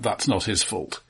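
ordinary talk, nothing marked

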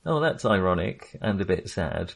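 normal talking voice

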